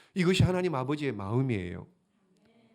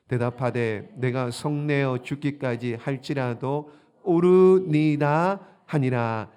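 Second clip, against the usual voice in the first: scripture reading; 7 dB louder, slower than usual. Acted. Congregation reads scripture aloud.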